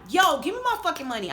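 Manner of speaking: retold heated demand, short of yelling; angry demand. Demanding money back in acted quarrel.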